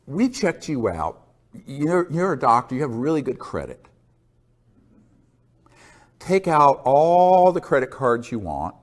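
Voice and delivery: imitating a smooth recruiter; mock recruiter pitch. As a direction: sell it like a shady recruiter. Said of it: "This is how they operate".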